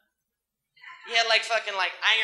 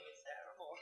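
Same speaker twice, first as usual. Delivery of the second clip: ordinary delivery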